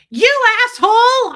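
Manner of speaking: very high, loud indignant shout; outraged yell. Retold or imitated. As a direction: yell it at the cat in hammed-up outrage. Retells outburst at cat found alive.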